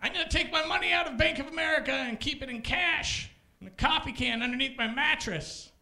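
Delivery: huffy customer. Retold or imitated. Comic imitating a customer quitting the bank, huffy. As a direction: declare it like a stubborn protester.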